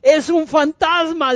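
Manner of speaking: high, drawn-out cry of fright; dramatized frightened shout; acted terror. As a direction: cry out as if terrified by a ghost. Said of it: "Quedaron aterrados".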